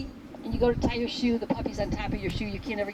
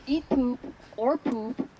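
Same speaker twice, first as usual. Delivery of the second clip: ordinary talk, nothing marked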